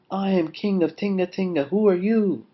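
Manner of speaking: high-pitched, bold call into the cave; brave answer. A narrator's reading. Brave Lion answers the big voice, calling out. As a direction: call back bravely, pushing the last words. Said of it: "answered the big voice".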